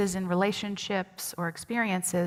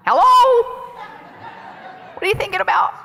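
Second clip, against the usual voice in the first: much higher and louder, laughing; teasing call out; 13 semitones higher, 8 dB louder than usual. Real, not acted. Laughing loud call to a silent congregation for an answer.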